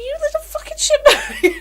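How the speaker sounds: high and slow, laughing through the insult; laughing scold. Retold or imitated. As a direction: scold while cracking up. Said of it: "And I said".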